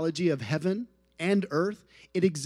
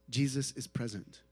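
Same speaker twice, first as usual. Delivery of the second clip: ordinary talk, nothing marked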